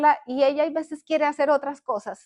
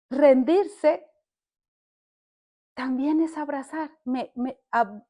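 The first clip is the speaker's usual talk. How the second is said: ordinary delivery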